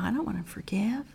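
whispered pouting refusal; sulky whisper. Acted. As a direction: pout it under your breath.

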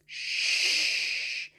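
whispered, drawn-out greetings; as soft as possible. Shown as a demonstration. Host greeting babies in three languages, whispering. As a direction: hush it right down to a whisper. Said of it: "as softly as we can".

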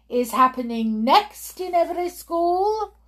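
put-on high, rising accented question; witch's shout. Witch goading her audience, high accented shout. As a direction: throw the question out to the crowd. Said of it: "shouted the Grand High Witch".

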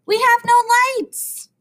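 high, loud scolding; exasperated complaint. A narrator's reading. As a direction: complain loudly at the latecomer. Teacher scolds late class president.

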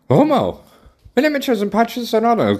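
high and loud, worked up; indignant. Real, not acted. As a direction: push it up, exasperated. Indignant rant. (empört und laut)

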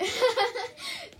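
bright burst of laughter; high laugh. Real, not acted. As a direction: laugh out loud at the joke. Laughs at self-praise.

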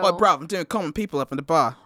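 casual put-on British accent; chatty British lad at karaoke. Shown as a demonstration. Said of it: "start the British accent".

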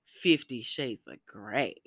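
low, slow and sultry, drawn out; a low slow tease. Shown as a demonstration. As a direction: purr the title like a seduction. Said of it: "but, like".

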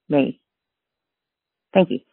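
usual speaking voice

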